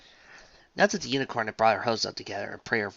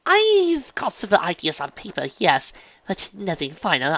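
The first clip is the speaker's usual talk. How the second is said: posh lady voice; 6 semitones higher, 7 dB louder than usual. A narrator's reading. Rarity improvising.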